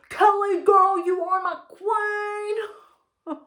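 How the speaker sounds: high, loud, slow American drawl; mock American shouting down the line; American accent. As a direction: shout it like a Texan on the phone. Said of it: "He's shouting down the phone".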